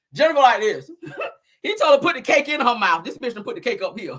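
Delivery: ordinary talk, nothing marked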